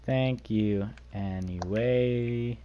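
very slow "anyway", stretched long; drawn-out thanks. Pretend. Streamer repeating thanks to a viewer, slowly drawn out.

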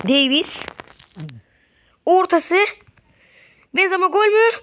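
climbing to a shout; building excitement. Real, not acted. Attack building to chance.

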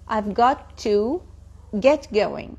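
"got to" drawn out slowly, then "get going"; slow breakdown. Shown as a demonstration. Teacher taking students through the phrase bit by bit, slowly. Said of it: "خلوني افصل لكم اياها شوي شوي".

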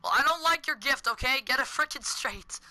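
ordinary talk, nothing marked

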